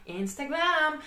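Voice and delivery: high, drawn-out silly voice; slow, put-on singsong mockery; mocking drawl. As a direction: stretch the name out mockingly. Mocks the social network's name.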